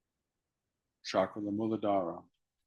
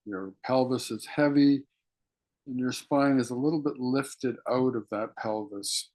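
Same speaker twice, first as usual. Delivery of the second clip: guided meditation. Acted. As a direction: draw them inward with hushed, patient instruction. Leads breathing meditation.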